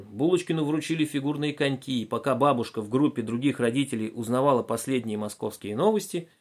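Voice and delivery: ordinary delivery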